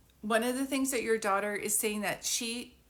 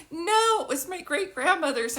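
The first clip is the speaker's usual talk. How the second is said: mock indignant protest; 7 semitones higher, 6 dB louder than usual. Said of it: "You're like".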